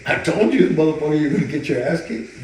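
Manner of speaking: high, loud told-you-so; gloating yell. Retold or imitated. Trainer gloating at his beaten brother, loud told-you-so. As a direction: rub it in loud.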